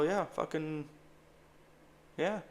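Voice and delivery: ordinary talk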